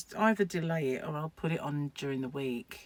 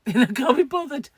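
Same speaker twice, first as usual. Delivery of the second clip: laughing it off, louder and higher; 7 semitones higher, 8 dB louder than usual. Real, not acted. Vlogger laughing to viewers about router setup, laughing. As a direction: giggle through can't be bothered.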